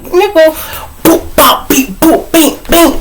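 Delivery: high-pitched cartoon punches, rapid and popping; mimicked punch noises. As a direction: squeak out each blow as a sound effect. Mimics punches thrown in anger.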